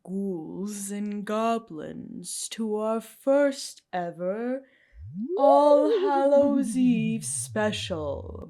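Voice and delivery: slow, booming spooky host voice; horror host. Pretend. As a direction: welcome the ghouls like a creepy TV host. Spooky intro for Halloween episode.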